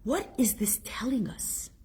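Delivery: hushed rhetorical whisper. Acted. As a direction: lean in and whisper the question. Rhetorical question whispered to congregation.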